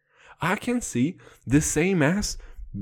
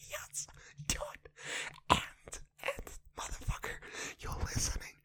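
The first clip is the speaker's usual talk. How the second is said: soft, airy whispered venting; voiceless, squeezed confession; strained whisper; 10 dB quieter than usual. Pretend. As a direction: whisper the woes with emphatic little yeses. Venting problems in a failing voice.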